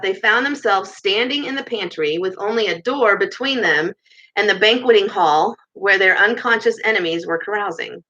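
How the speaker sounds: ordinary delivery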